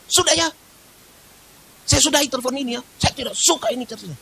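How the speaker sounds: mock the caller hanging up angrily; angry caller. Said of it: "Ini sebentar orang bamaki loh".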